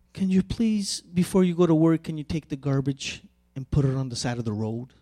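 soft, quiet, kindly asking; gentle polite request. Shown as a demonstration. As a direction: ask softly and nicely. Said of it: "That's one way of saying a communication. The tone is different".

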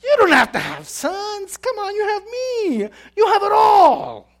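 husband insisting he is all she needs, mocked. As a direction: overplay the husband's pleading. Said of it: "this guy was saying".

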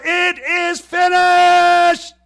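huge, drawn-out high scream. Acted. Jesus screaming his last words from the cross, full-force scream. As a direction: scream each word slowly at full power. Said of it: "He screams out".

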